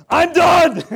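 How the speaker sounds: mock furious Moses. Moses coming down the mountain, hammed-up loud yell. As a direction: blow up like you've had it. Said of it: "he was like".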